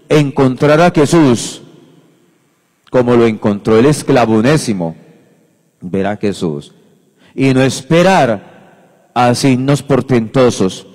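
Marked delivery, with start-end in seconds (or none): none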